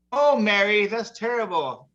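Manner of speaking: high, slow sympathetic priest; consoling priest. Retold or imitated. Priest consoling the widow, high and slow. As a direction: priest consoling, pitched well up.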